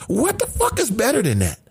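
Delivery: pitched high, delighted and emphatic; excited. Real, not acted. Host raving to viewers about breakfast. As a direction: sell it like the best thing ever.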